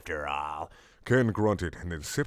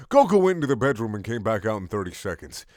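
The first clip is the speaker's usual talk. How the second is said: ordinary talk, nothing marked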